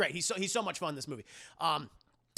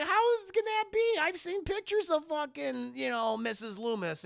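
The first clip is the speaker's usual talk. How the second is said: high, loud stunned disbelief; mock disbelief; 10 semitones higher, 3 dB louder than usual. Gail confronting Mrs. Loomis, high mock disbelief. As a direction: gasp it out in shock. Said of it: "Gail's like".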